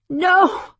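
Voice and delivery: high, loud scream; screamed refusal. A narrator's reading. Woman screaming at the alien ship. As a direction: scream it out in defiance. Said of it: "she screamed".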